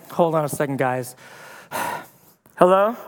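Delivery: low, hushed aside then sighing hello; weary busy official. Retold or imitated. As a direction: excuse yourself from the big meeting. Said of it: "he's like".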